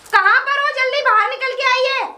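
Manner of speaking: loud, high call to her husband; angry shouting. Acted. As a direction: yell for him to come out at once. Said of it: "कैसे चिल्ला रही हो".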